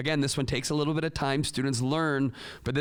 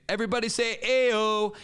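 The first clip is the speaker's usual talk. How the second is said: high, loud rallying call; teacher's call-out; 9 semitones higher, 6 dB louder than usual. Shown as a demonstration. Teacher prompting kids to respond, high loud call-out. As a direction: rouse the whole class to answer. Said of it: "the teacher can say".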